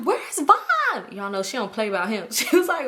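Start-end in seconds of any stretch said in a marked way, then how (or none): 0.0-0.6 high, pressing friend voice, much higher; Toya demanding. Retold or imitated. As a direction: demand it like a jealous girlfriend. Friend Toya demanding her boyfriend.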